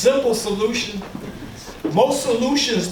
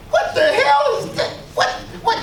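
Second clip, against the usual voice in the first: shocked outburst; 12 semitones higher than usual. Retold or imitated. Shocked viewer reacting to TV.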